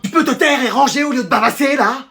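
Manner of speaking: high, nagging scolding; mother yelling. Acted. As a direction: tear into your idle kid, pitched high. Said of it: "moi tu me cries dessus". (mère excédée qui gronde)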